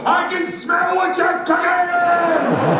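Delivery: high, drawn-out full-force bellow; loud as possible. Retold or imitated. Fan stands up to get the Rock's attention, bellowing shout. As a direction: roar the catchphrase like a wrestler's fan. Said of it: "loud as I can".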